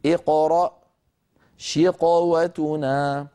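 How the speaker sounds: slow, full emphatic ق in اقرأ and شقوتنا; correct heavy recitation. Shown as a demonstration. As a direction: recite slowly, every ق fully heavy. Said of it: "بل يقولون".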